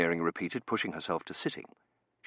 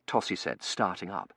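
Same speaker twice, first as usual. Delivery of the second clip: plain reading voice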